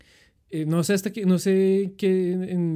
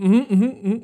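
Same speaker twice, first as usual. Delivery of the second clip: nodding ujú; 5 semitones higher, 8 dB louder, faster than usual. Retold or imitated. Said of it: "con la cabeza como".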